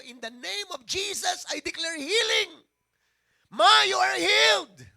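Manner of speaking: building to a loud, slow commanding declaration; forceful prayer. Retold or imitated. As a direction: son praying healing over his hospitalized mother, commanding loudly. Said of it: "ang lakas ko mag-pray".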